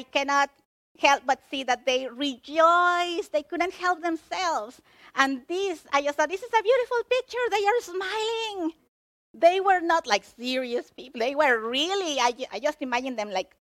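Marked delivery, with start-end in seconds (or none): 6.3-8.7 high, quick, delighted outburst; delighted exclamation. Retold or imitated. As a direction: gush over it in a raised pitch. Excited retelling of own thought.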